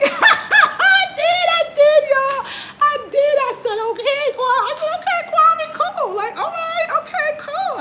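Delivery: high, loud laughing "okay"; high giggling agreement. Real, not acted. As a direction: giggle out an eager yes. Laughing, retelling her reply.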